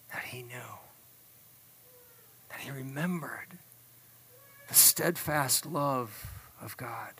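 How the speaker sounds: soft, slow, near-whispered awe; hushed preaching. Real, not acted. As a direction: let it sink almost to a whisper, with pauses. Hushed climax of sermon story.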